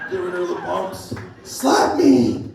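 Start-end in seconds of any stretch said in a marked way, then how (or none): 1.4-2.4 higher, louder hammed-up demand; mock demand. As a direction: bark it like a bossy lover. Girlfriend demanding in story.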